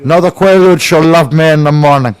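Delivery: a hint of a put-on Cuban accent; lightly voiced Scarface gangster; mild Cuban gangster voice. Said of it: "that's a Cuban".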